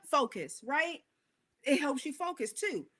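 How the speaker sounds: ordinary delivery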